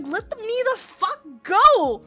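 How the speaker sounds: mock angry scream; retold yell. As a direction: shout it like you're being dragged. Shouting while hair gets pulled.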